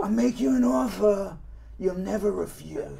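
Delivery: Godfather voice out of the side of the mouth; Godfather impression. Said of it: "That's good".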